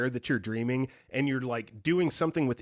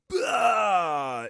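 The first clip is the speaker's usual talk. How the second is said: harsh throaty yelled bah; guttural cry; 7 semitones higher, 4 dB louder than usual. Retold or imitated. Roommate wakes from nightmare, guttural shout. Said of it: "in the most guttural voice".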